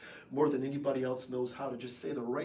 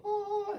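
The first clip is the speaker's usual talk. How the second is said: high, held heavenly note; mock angel-choir tone; angelic "ah". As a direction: sing a sweet sustained "ah". Angelic note for lovely Abigail.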